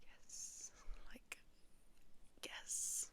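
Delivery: hushed, gleeful whisper to yourself; a silent fist-pump of a whispered yes; whispered triumph. Retold or imitated. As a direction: breathe out a tiny victorious yes. Quiet triumph at brother's mistake.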